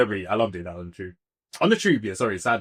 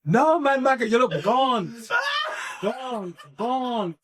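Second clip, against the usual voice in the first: put-on high, drawn-out Jamaican accent on gaunt; Jamaican mum impression; 8 semitones higher, slower than usual. Speaker mimicking mum on looking gaunt, high mock Jamaican dismay. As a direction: imitate a Jamaican mum exclaiming. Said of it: "This is the thing about Jamaicans".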